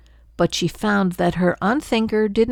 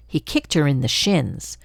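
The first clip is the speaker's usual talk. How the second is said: ordinary delivery, nothing marked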